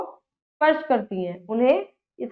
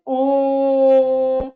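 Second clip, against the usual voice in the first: "ओम" को देर तक खींचकर, धीमे और गूंजते हुए; एक ही सांस में ओम को लंबा तानो; बहुत लंबा खिंचा ओम; 5 dB louder than usual. Shown as a demonstration. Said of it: "तीन गुना अधिक समय". (प्लुत स्वर में बहुत लंबा खिंचा ओम)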